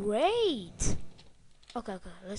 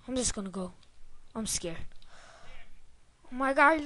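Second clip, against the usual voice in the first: usual speaking voice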